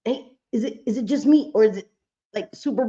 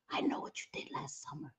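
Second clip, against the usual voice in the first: whisper; 19 dB quieter than usual. Retold or imitated. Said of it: "He's like".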